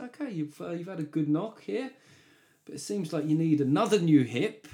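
high, gently regretful doctor voice; mock doctor. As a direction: play the doctor breaking bad news kindly. Doctor voiced telling patient news.

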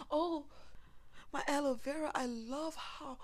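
shower the plant with loud love; praising plant. Pretend. Speaker talks to her aloe vera, loud gushing thanks. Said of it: "So you tell the plant".

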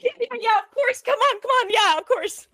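very high, hurried eager yeses; flustered cashier. Retold or imitated. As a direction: falsetto clerk rushing to help. Cashier hurriedly waves her through.

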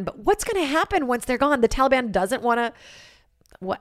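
usual speaking voice

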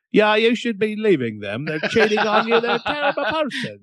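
put-on very high, hammy British accent; reply in an absurd high British voice; comic squeaky British advice-giving. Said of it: "always replied to British accent".

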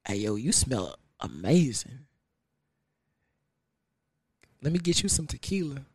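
deep, soft seductive voice; low and smooth, trying to charm her; a soft, deep come-on at the bar; smooth pickup line. Retold or imitated. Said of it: "I'd be like".

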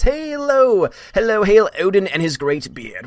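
high put-on voice hailing Odin; mock grand greeting. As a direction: read the fan's hail like a herald. Reading chat message in voice.

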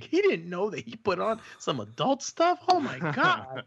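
high-pitched mocking kid voice; mocking daughters. Daughters laugh at the host afterwards, imitated high mocking voice. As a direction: imitate girls making fun of a clueless adult. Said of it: "laughing at me, like".

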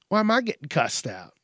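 usual speaking voice

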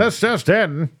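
high mock newsreader; mock old newscaster bulletin; news announcer voice. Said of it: "the thing we did in this voice".

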